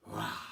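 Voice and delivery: long, very low roar; lion roar. A narrator's reading. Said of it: "he opened his mouth and he roared".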